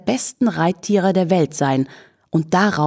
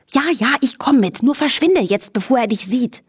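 high, impatient shooing; harried giving-in; 6 semitones higher than usual. A narrator's reading. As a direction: give in just to be rid of it. Horse urgently shoos the flea away.

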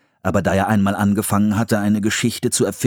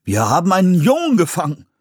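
high, loud astonished announcement; surprised; 11 semitones higher, 5 dB louder, faster than usual. A narrator's reading. Man finds a boy in the trap, surprised. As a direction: raise the voice in sheer surprise. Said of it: "stellte ein Mann überrascht fest".

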